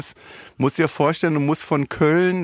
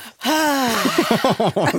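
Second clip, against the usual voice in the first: laughing; 8 semitones higher than usual. Real, not acted. Laughs at own joke.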